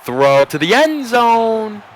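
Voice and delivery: high, loud, drawn-out announcer cry; excited play call. Real, not acted. Announcer calling a throw to end zone, excited. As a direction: stretch it out as the ball flies.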